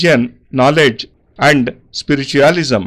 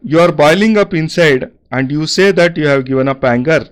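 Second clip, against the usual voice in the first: ordinary talk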